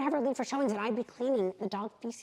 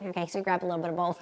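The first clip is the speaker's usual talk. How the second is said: ordinary delivery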